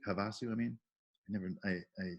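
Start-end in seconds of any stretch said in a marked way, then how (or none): 0.1-0.5 Havasu said softly, second syllable stressed; mispronounced place name. Real, not acted. Organizer unsure of a town's name, Havasu stressed mid-word. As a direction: stress the middle of Havasu, softer. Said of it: "haven't pronounced that out loud".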